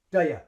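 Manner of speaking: "do ya" as quick "duh-yuh"; linked like one word. Shown as a demonstration. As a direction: run "do ya" together on one breath. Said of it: "like, one word".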